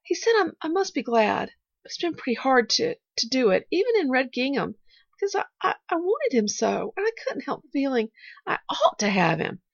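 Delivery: put-on earnest child with a touch of sadness; halting, then gently hopeful child; lightly voiced little girl. A narrator's reading. Pollyanna confiding in her supposed aunt, earnest and halting.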